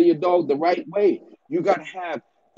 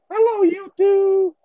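mocking a bassless YouTuber's hello; voice with no bass; 3 dB louder than usual. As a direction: mimic him, much higher and thin, no bass at all. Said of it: "No bass in his voice".

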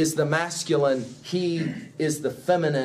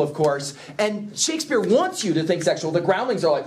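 usual speaking voice

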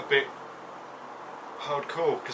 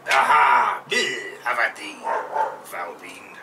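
stagey fake archaic declaiming; 6 semitones lower, 4 dB louder, slower than usual. Dwarf mock duel cry.

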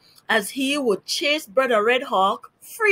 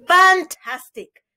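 excited exclamation; 9 semitones higher, 7 dB louder, slower than usual. A narrator's reading. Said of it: "exclaimed Parson Drunkrow".

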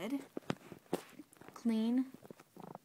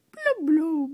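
fish talk; 5 semitones higher, 10 dB louder than usual. Pretend. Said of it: "I don't know what language you speak".

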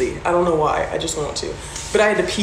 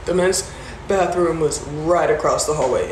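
ordinary talk

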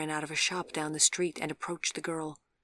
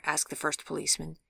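usual speaking voice

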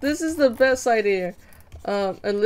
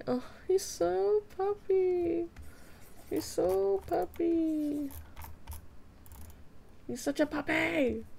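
cooing; 8 semitones higher, 7 dB quieter, slower than usual. Real, not acted. Artist cooing softly at the puppy character she drew. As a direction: coo over a cute puppy. Cooing over her drawing.

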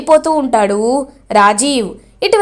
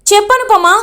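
higher, climbing demand; అరుస్తూ గట్టిగా; angry shout; 8 semitones higher than usual. A narrator's reading. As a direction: yell at her to speak. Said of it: "గట్టిగా అరుస్తారు".

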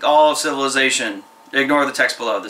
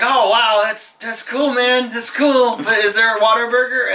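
higher, excited cartoon hero voice; Diaper Man voice; 6 semitones higher than usual. Diaper Man gushing to the bald mentor, higher and excited. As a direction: pitch it up and gush over the news. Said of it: "Diaper Man was like".

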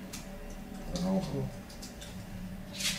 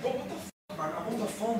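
hushed annoyed hiss; 3 semitones higher, 10 dB quieter than usual. Acted. Annoyed friend hushing caller.

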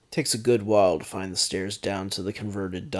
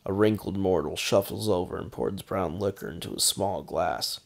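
plain reading voice

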